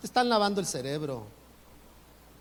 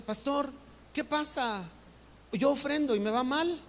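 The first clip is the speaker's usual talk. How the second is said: complaining churchgoer; 6 semitones higher than usual. Retold or imitated. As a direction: play a lightly voiced member grumbling that giving fails. Congregant complaining to pastor.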